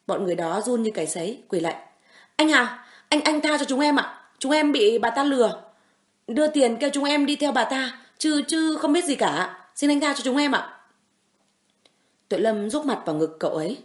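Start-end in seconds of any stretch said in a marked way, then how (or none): 2.4-10.8 high, loud, stammering plea; begging for mercy. Acted. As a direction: grovel and beg to be spared. Thugs kneeling begging mercy.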